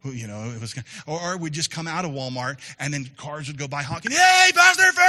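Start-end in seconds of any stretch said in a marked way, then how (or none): 4.1-5.0 loud, high call from a passing car; shouted greeting. Retold or imitated. Passing driver calling to the pastor, loud high shout. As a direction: yell it out the car window. Cars honking, people shouting.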